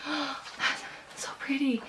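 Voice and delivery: soft, slow, hushed gasp of delight; gasping in awe. Retold or imitated. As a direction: gasp over beautiful pictures in a bookstore. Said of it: "and being like, gasps".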